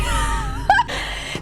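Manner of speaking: high squealing laugh of disbelief; burst of laughter. Real, not acted. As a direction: cracks up at the absurd question. Reacts to absurd post.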